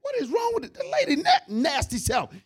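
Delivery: very high, loud squeal of outrage; shocked shriek. Retold or imitated. Joseph shrieks high and loud at the wife's advances. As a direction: screech in comic horror. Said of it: "He's like".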